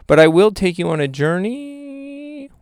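slow, sliding up high on the last word; odd put-on voice. Host grandly promising listeners a journey, silly voice. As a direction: stretch the ending into a silly rising voice. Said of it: "What was that voice?".

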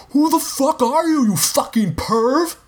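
very high, outraged yell; shouted after him. A narrator's reading. Rob yells after the running narrator, outraged shout. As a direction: yell after the fleeing intruder. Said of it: "Rob shouted after me".

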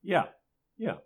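ordinary talk

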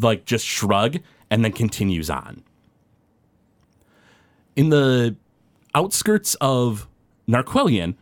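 ordinary delivery

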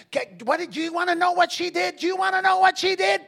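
high, loud rising challenge. Retold or imitated. Preacher challenging the mother, loud and high. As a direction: fire back hard at her.

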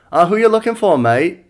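imitated Aussie accent that slips toward British; attempted Australian accent. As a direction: answer the phone in a shaky-British take on Australian. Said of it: "every time I try to do Australian".

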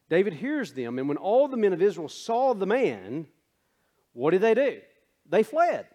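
emphatic sermon delivery; preaching. Acted. Preaches on fleeing from fear.

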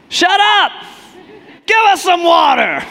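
high, loud heckler yelling from the crowd; shout. Retold or imitated. Thirsty heckler yells at Moses, loud and high. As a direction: bellow it like a rude heckler. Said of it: "shouts out".

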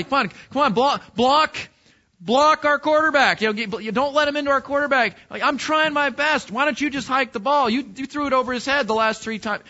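loud, high, heated teammate yelling; angry quarrel. Pretend. Teammate yelling at the center in the huddle, angry. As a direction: bark at the center like a fed-up lineman. Acted locker-room quarrel on team.

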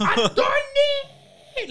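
high, loud drawn-out mock scream; mother screaming, exaggerated and slow; imitating screaming mom. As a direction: stretch it into a long mimicked screech. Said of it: "She's clearly screaming".

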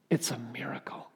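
hushed whispered "It's a miracle". Shown as a demonstration. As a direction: breathe "It's a miracle" in awe. Repeated line, now whispered.

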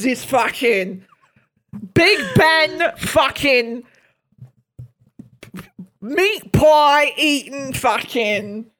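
hammed-up English accent, slow and ranting; mock English accent. Ranting about the English taking over the language, mock English accent. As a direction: rant in a put-on English accent, drawing the words out. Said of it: "I'm doing an English accent".

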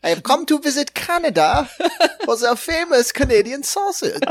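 put-on high, deliberate German accent; hammed-up German visitor's accent; mock German accent. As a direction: play a German tourist, accent laid on thick. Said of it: "I think Tony from Wales is German".

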